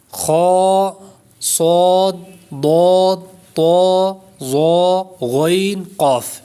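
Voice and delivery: خو، صاد، ضاد held long with thick, rounded vowels; full-mouthed heavy letters. Shown as a demonstration. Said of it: "ان کو پر کر کے یعنی موٹا کر کے پڑھنا ہے".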